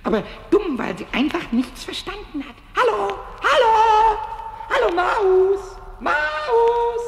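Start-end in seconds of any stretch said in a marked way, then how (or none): 2.8-7.0 high, loud drawn-out call. Acted. Pumuckl calls the vanished mouse, loud and drawn-out. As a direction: call slowly into a hole, stretching the vowels.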